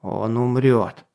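slow, grim knight's growl. A narrator's reading. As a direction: growl it slowly and grimly. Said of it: "прорычал северный рыцарь".

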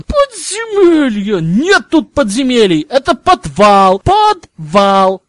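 high, loud indignant hissing; furious hiss. Acted. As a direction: hiss it out, outraged. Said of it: "шипел он".

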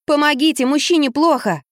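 high, pressing call for help; urgent plea. Acted. Woman begs police to help a sick man, urgent. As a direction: plead urgently into the phone, pitched high.

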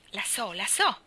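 put-on keen child calling out the answer; eager child. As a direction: blurt it out like a proud little pupil.

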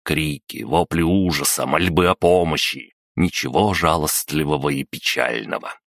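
usual speaking voice

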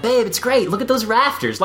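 high, eager husband voice; excited husband. Retold or imitated. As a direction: sell the place enthusiastically. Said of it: "he's like".

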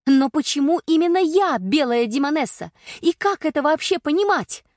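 high, loud petulant demand; capricious child. Acted. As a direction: demand answers like a spoilt child. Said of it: "напоминала себе капризного ребёнка".

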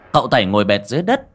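ordinary delivery

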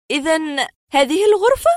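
high, loud puzzled question; bewildered woman. A narrator's reading. Patient questions the doctor about the room, bewildered. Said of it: "قالت في حيرة".

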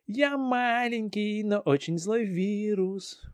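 slow, grimacing sing-song; mocking radio voice. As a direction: drawl it out, pulling faces. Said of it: "вязкий, кривляющийся голос".

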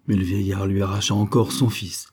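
plain reading voice

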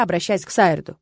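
ordinary delivery

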